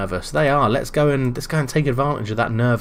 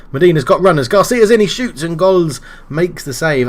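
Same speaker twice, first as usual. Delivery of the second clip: rising excitement on the attack, louder and higher; excited commentary; 5 semitones higher, 5 dB louder than usual. Real, not acted. Calling his own team's chance, excited and louder. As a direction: call the chance like it might go in.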